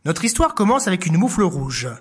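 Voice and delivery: plain reading voice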